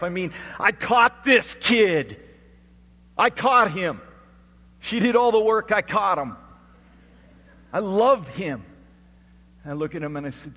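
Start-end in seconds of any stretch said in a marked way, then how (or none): 0.0-8.6 loud, high, emphatic outburst; impassioned preaching. Real, not acted. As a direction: belt out each short declaration.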